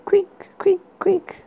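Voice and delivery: high breathy creak sounds; whispered creaking. Acted. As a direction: whisper the bed's creak eerily. Creaking bed sound imitated.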